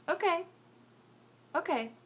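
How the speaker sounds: high squeak of forced agreement, then dropping; a pained, high okay; squeaky resigned okay. Retold or imitated. Resigned to eating ice cream.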